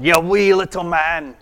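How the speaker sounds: put-on playful Scottish accent, laughing through it; mock Scottish insult. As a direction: tease in a put-on Scottish burr. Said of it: "a Scottish or Irish insult".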